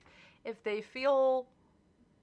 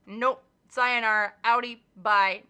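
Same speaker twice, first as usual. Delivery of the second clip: louder, dismissive sign-off; mock yelled goodbyes; 11 dB louder than usual. Telling off toxic people, louder and dismissive. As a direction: slam the door on them with each word. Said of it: "I'm not yelling at you".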